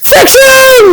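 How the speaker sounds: high-pitched, passionate exclamation. Shown as a demonstration. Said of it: "Say it with more passion".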